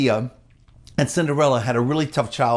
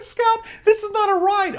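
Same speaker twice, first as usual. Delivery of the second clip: high-pitched. Retold or imitated. Dad protesting, voice jumps high.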